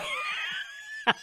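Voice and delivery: very high shriek of laughter. Real, not acted. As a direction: let out a high squealing laugh. Cracks up at joke.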